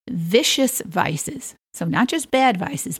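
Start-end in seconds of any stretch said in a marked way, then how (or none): 0.1-1.6 slow relish. Acted. Reading list entry, drawn out.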